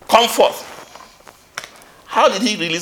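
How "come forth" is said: commanding call. Retold or imitated. Jesus calling Lazarus from tomb.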